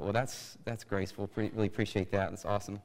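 low, quiet deadpan sarcasm; dry sarcasm. Retold or imitated. Preacher reacting to a scolding Facebook comment, dryly sarcastic. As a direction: mumble thanks you clearly don't mean.

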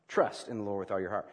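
"Trust" set apart, rest low and even; stress on Trust. Shown as a demonstration. Preacher repeating scripture to the congregation, stress on "Trust". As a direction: lean on "Trust", then pause briefly. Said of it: "Let's put the emphasis on trust".

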